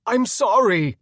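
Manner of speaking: high, loud pleading cry; loud anguished sorry; desperate apology. A narrator's reading. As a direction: shout the apology after them. Begging friends who flee.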